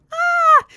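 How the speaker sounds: very high, loud short squeal; excited squeal. Real, not acted. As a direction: let out a piercing happy scream. Excited about Disney birthday trip.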